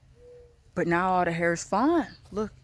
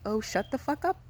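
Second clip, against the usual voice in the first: higher-pitched, mock dismissive retort; imitated attitude; 6 semitones higher, 4 dB quieter than usual. Voicing other people brushing off a complaint, with mock attitude. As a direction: put on some attitude without raising volume. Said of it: "Like, they attitude".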